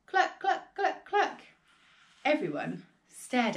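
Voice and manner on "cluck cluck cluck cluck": high, short clucks; hen clucking. A narrator's reading. Big brown hen sitting in the yard, high clucking. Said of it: "the big brown hen sat down".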